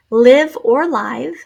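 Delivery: "Live" with long I then "live" with short i; two pronunciations of live. Shown as a demonstration. Teacher contrasting the two sounds of "live" for students. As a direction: say "Live or live" first as laɪv, then as lɪv. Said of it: "the word was live, but it's spelled just like live".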